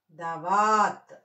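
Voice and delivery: slow దవాత్ with a long vaa; teacher's drawn-out model of దవాత్; pronunciation demo. Shown as a demonstration. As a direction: stretch వా in దవాత్, end on bare t. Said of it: "దవాత్ అని పలకాలి".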